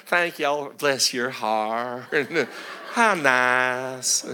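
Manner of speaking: slow, hammed-up Southern drawl; Southern accent. Preacher mimicking polite Southerners, Southern accent. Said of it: "And in the South, they say".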